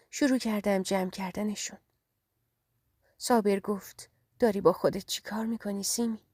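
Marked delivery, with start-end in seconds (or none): none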